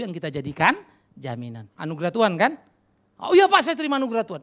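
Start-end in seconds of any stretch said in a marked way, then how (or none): 3.2-4.4 high, fast, hammed-up voice of a self-assured churchgoer; mock smug churchgoer. Preacher mocking a churchgoer who claims grace, in a high, fast voice. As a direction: toss off the pious answer in a quick, high, mocking pitch.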